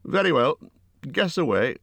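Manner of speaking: a brave hobbit daring him to guess; lightly voiced Bilbo. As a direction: Bilbo bravely answering Gollum.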